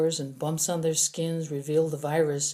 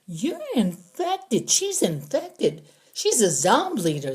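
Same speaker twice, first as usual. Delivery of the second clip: higher, slow, pointed voice; accusing prisoner; 7 semitones higher, 3 dB louder than usual. A narrator's reading. Prisoner accusing the girl of being infected, slow and higher. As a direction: drag out the accusation.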